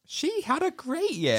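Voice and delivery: squeaky teasing repeat; high mock echo. Echoes in high put-on voice.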